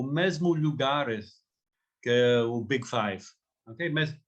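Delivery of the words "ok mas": usual speaking voice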